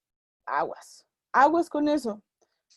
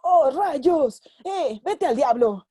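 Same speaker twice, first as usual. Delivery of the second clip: louder, exaggerated dubbed-movie line; cheesy TV dubbing; 3 semitones higher, 6 dB louder, slower than usual. TV dub actor softening a curse, loud and hammy. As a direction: ham it up like a censored network dub. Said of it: "Entonces, ¿qué decían?".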